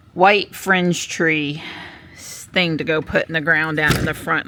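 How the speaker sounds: usual speaking voice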